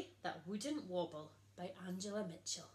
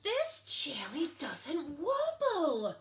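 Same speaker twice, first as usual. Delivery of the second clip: slow, louder, higher cry of horror; horrified exclaim; 6 semitones higher, 8 dB louder, slower than usual. A narrator's reading. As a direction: gasp it out in shock. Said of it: "exclaimed Princess Lolly in horror".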